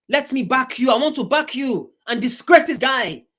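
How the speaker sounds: loud, high-pitched exasperated appeal; worked-up outburst. Real, not acted. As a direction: raise your voice and plead your case. Worked up, addressing the woman.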